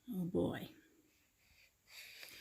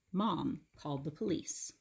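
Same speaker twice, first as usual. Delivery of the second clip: plain reading voice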